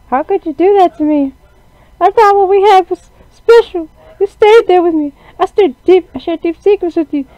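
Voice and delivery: put-on high, pleading girl voice, hurt; high heartbroken character voice; hurt Zombie voice.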